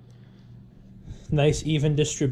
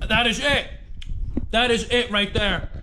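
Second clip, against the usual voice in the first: excited; 7 semitones higher than usual. Real, not acted. Tasting the chowder, thrilled.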